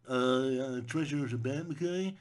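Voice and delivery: slow, dopey voice with a rising okay; dumb moralizing voice. Acted. Mocking the films' anti-treasure moral, dopey voice. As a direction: play the movie as a dim preacher.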